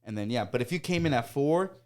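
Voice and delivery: ordinary talk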